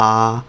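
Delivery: আ as an open Chinese a; pinyin final a. Shown as a demonstration. Teacher modelling the pinyin final আ. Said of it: "এর উচ্চারণ হবে স্বর আর আকার".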